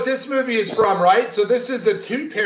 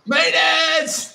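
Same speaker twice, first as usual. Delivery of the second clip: calling out; 7 semitones higher, much slower than usual. Acted. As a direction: holler it like a slow announcement. Calling out as an intruder.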